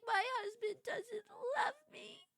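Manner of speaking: high, whiny fake sob; mock crying. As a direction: wail it like a hammed-up sob. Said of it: "I was like".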